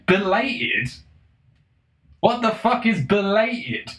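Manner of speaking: high-pitched, baffled disbelief; incredulous outburst. Real, not acted. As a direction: repeat it in rising outrage, then slow down. Incredulous at the word belated.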